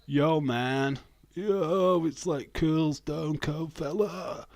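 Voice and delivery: loud, high-pitched put-on American dude; American dude accent.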